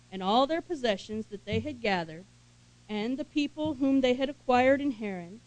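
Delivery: loud, full-voiced Bible reading; scripture reading. Preacher reading Genesis to his congregation, loud and proclaiming. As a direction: proclaim the passage to the room.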